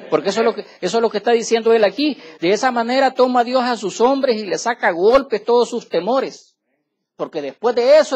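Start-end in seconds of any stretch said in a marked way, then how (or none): 2.4-8.1 fiery preaching. Acted. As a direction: preach every word out to the congregation. Preaching at full force.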